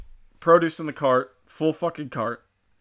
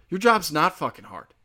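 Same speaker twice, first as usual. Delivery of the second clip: normal talking voice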